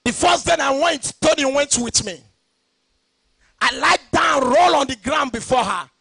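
loud, high, driving pulpit shout; fiery sermon cadence, ringing out; preaching at full force. Acted. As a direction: hammer each line home to the church. Preaching own testimony.

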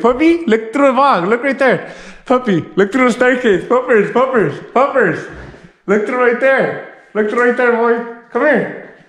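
high-pitched puppy talk; coaxing the dog. Real, not acted. Owner to puppy for the vlog, high coaxing. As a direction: coax a pet in high, falling phrases.

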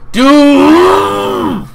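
very high, loud shout of outrage; furious shout. Real, not acted. Gamer shouting at the game, furious outburst. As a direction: yell it full force.

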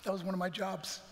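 ordinary talk

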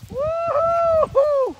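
slow, high whoop; excited whoop. Real, not acted. Owner cheering his dog's chase, high excited whoop. Cheering dog chasing toy.

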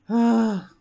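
loud, sharp exhaled sigh. Real, not acted. Player in the dark among monsters, loud sigh. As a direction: let out a big tense breath.

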